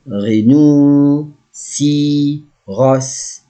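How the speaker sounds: "Rhinocéros" very slow, with gaps between parts; syllable by syllable. Shown as a demonstration. Teacher models a new animal word, syllable by syllable. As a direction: stretch "Rhinocéros" into ri-no-cé-ros.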